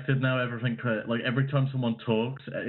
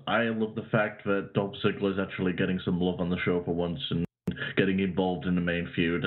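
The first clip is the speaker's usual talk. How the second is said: ordinary delivery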